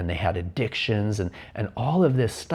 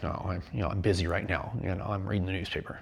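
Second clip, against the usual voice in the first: quieter, offhand dismissive dad; busy dad; 3 semitones lower, 6 dB quieter, faster than usual. Retold or imitated. Dad reading the paper, waving off his son: quiet, offhand. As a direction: brush the kid off without looking up.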